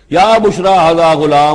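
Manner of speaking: high, loud recited cry; excited call. Retold or imitated. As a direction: call out the news as a recited verse. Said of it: "انہوں نے وہیں سے شور مچایا، آواز دی".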